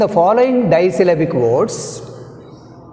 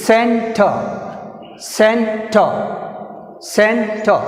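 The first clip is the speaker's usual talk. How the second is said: Center split as held CEN, falling -ter; first-syllable stress; 3 semitones higher, much slower than usual. Shown as a demonstration. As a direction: push CEN in Center, then drop. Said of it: "C, first syllable".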